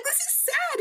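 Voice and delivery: very high retold whine; mock plaintive protest. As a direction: act out a tearful high-pitched protest. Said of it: "I'm like".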